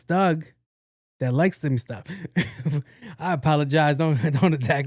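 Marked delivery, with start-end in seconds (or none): 2.1-2.8 laugh. Real, not acted. Laughs at own joke.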